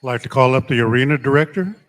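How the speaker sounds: loud arena call-out; powwow emcee. Real, not acted. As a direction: call them up over the crowd. Calling people to the arena.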